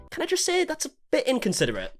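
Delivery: high, soft, teasing complaint; mock offended. Real, not acted. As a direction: playfully scold her.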